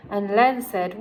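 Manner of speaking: ordinary delivery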